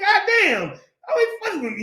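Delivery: very high mock outburst; hammed-up, high-pitched complaint; David's exasperated comeback. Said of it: "that's how we would say it today".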